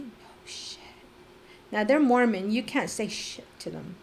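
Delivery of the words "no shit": breathy stunned whisper under the breath; hushed shocked whisper. Retold or imitated. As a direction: mouth it in disbelief, barely any voice. Stunned private reaction to news.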